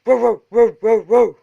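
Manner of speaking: loud barking row row; dog barking. A narrator's reading. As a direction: bark it like a big dog. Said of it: "said Bottomley Potts".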